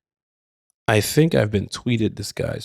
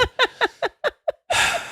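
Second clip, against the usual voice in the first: high giggle; 4 dB quieter than usual. Real, not acted. Reading letter, laughs at it.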